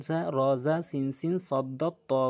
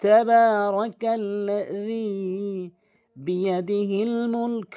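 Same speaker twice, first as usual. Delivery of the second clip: slow, higher, drawn-out tajweed chant; Quran recitation; 6 semitones higher, 5 dB louder, much slower than usual. Shown as a demonstration. Teacher recites the verse for learners, measured Quran recitation. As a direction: recite the verse with long held vowels.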